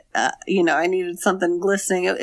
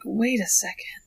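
sudden suspicious whisper; 9 dB quieter, slower than usual. Retold or imitated. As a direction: whisper it like you just caught on. Character realizes camera sees house.

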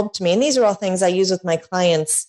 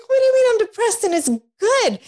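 fast, high, rushed incredulity; incredulous; 12 semitones higher, faster than usual. Retold or imitated. Pressing her friend about being depressed, fast high incredulity. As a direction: blurt it out high and quick in disbelief. Retold shock at friend's words.

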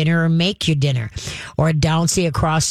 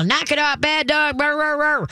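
high-pitched screaming at the dog; the wrong way, shrieking high; mock screaming at dog; 9 semitones higher than usual. Shown as a demonstration. Trainer showing owners what not to do, mock screaming. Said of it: "Don't start screaming".

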